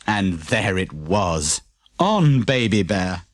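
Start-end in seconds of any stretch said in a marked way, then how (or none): none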